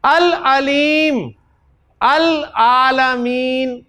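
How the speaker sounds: Arabic words stretched slowly; slow recitation. Shown as a demonstration. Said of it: "ऐन". (धीमा, खींचा हुआ उच्चारण)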